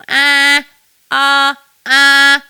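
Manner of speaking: loud "ah" with lifted ping; twangy ah. Shown as a demonstration. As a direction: lift the throat a tiny bit for twang. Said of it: "lift it just a tiny bit".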